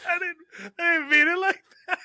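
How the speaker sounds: laughing too hard to defend himself; laughing protest. Real, not acted. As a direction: protest while laughing helplessly.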